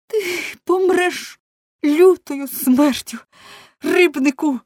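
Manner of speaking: very high, very loud slow curse; furious shout. A narrator's reading. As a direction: scream the curse at him slowly. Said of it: "скрикнула Соткін". (Соткін проклинає рибника: дуже високий, гучний крик)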